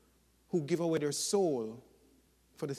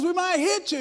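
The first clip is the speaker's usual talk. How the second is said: high, loud mock shout; shouting back; 7 dB louder than usual. Friends below answering John, high mock shout. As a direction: call the warning up into the tree.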